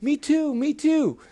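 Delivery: high-pitched eager clamour; eager frogs. Acted. Other frogs begging to join the ride, eager. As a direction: pipe up like frogs wanting a turn.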